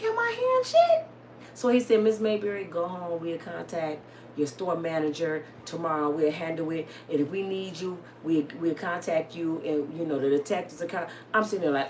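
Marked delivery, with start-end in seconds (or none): 0.0-1.1 very high, squeaky astonishment; comic high-pitched disbelief; disbelieving. Retold or imitated. Acts out her own disbelief. 2.0-11.1 low, soft official reassurance; understated, matter-of-fact policeman; calm officer. Retold or imitated. Acts out calm officer's instructions.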